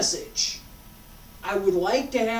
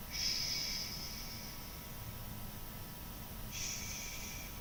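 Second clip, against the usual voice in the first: put-on a soft, voiceless shush as if calming a crying baby; soothing shush; 16 dB quieter than usual. Shown as a demonstration. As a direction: hush a baby gently. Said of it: "What do you do?".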